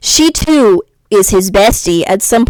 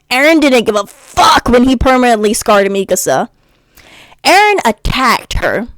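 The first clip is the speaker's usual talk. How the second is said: ordinary talk, nothing marked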